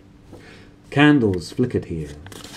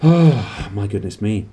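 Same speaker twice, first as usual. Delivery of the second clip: relieved sigh; 5 dB louder, slower than usual. Real, not acted. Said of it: "reader reacts after reading".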